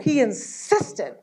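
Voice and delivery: ordinary delivery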